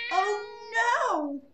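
high, loud weary groan of a fed-up father; a tired dad at the end of his rope; exasperated Mister Bear. A narrator's reading. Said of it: "said Mister Bear".

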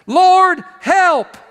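desperate loud cry for help. Acted. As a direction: cry out to the Lord, pleading. Sailors crying out again.

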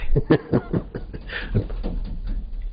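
soft breathy laugh spilling out; airy giggle escaping; Brahma's laugh. Acted. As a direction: let a hidden chuckle bubble up. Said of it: "हंसी छलकाई".

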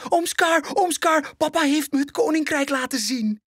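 veel hoger en luider, opgewonden kinderstem; excited cub. Acted. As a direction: ratel het eruit als een opgetogen welp. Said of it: "vertelt Simba opgewonden". (Simba vertelt zijn oom opgewonden, hoog en luid)

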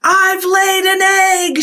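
high, loud, slow squeal of joy; excited shriek. Acted. Marjorie announcing her egg to the barnyard, shrieking. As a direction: shriek it out, thrilled. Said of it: "shrieked Marjorie".

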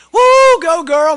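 put-on whooping high, loud cheer at a passing girl; a loud, high rowdy whoop; hollering cheer. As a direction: holler it across the slope like rowdy teens. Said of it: "they start hollering at her".

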